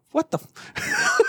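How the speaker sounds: high, slow startled cry; mock shock. Coworker quotes the show, he acts shocked. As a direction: act floored by the quote.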